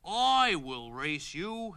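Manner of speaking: slow tortoise challenge. Acted.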